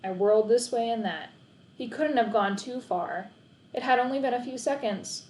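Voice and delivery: plain reading voice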